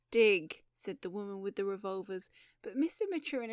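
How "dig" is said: loud, curt command; one sharp loud word; barked order. Acted. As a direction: bark it out as an order not to be questioned. Armed woman repeats her order.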